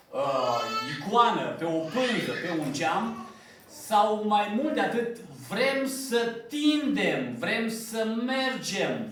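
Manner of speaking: impassioned pulpit delivery, slowing on the last words; fervent preaching. Acted. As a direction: preach it, lifting the congregation. Preaching builds to high pitch.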